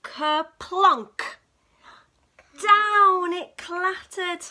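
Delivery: loud, slow sound-effect boom; loud crash. A narrator's reading. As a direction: bang it out like the clatter. Spoon falls loudly.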